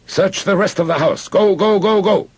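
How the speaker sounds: high, loud urgent command; shouted order. A narrator's reading. Officer sending soldiers through the house, shouted orders. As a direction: yell it, driving the men on. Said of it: "the officer shouted".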